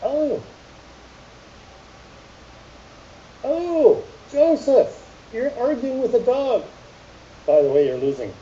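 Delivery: high, startled self-talk "oh, oh, Joseph"; mock realization. Man catching himself arguing with a dog, surprised. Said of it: "like, I notice".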